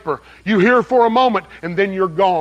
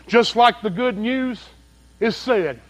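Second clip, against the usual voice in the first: ordinary delivery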